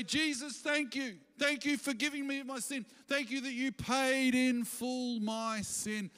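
mean every thank you, slowly at the end; grateful prayer. Pretend. Preacher voices a grateful believer.

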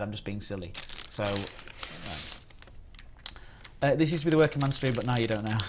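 ordinary delivery

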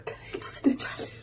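whisper. Real, not acted. Begging, spice overwhelmed.

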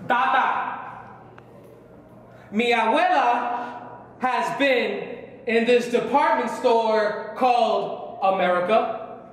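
very slow, drawn-out recitation; slow poem recital. Acted. Poet telling of an abuela in America, slow recital. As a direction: let each phrase hang, with long pauses.

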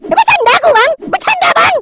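very high, frightened backpedalling; frantic squeal of excuse; panicked pleading. Acted. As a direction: beg high-pitched that it was a joke. Pleads after nearly being slashed.

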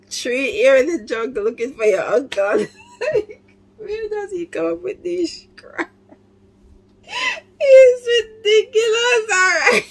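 laughing so hard it breaks into crying; can barely get words out between laughs; real tearful laughing fit; helpless laughter. Real, not acted. Reactor laughing through all lines.

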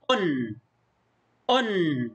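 أن as "un", ending on a light held n; isolated أن, said "unnn" for pupils; damma tanween. Shown as a demonstration. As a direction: repeat أن with the nun lingering. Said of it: "لاحظ النون الساكنة الخفيفة في النطق".

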